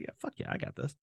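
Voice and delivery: breathy whisper, psyching himself up; whispered self-hype. Retold or imitated. Hushed to himself, a cook hyping himself up. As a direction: hype yourself up under your breath.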